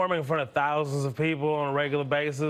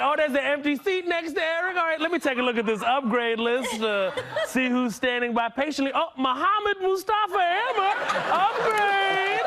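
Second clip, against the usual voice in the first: high-pitched mock agent voice; imagined gate agent; 12 semitones higher, 3 dB louder than usual. Gate agent calling a passenger up from the upgrade list, mock high announcing.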